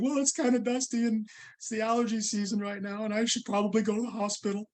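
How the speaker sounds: high, mock-casual excuse; playing it down. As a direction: brush it off with a fake-innocent tone. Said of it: "And I said".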